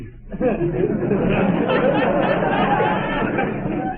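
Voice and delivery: very high-pitched, drawn-out cackle; long laugh. Acted. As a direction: laugh long and high at your own joke. Visitor laughs at own joke.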